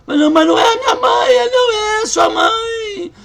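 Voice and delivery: the woman crying that it isn't her mother; crying shout. Retold or imitated. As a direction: wail the denial through tears. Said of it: "gritar e chorar".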